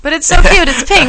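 high, excited gush. Real, not acted. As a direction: gush about how cute it is. Gushing over cute computer.

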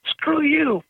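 high, snapped at the therapist; imitating a defiant teen lashing out; angry kid in treatment telling off the therapist, high-pitched. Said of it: "He goes".

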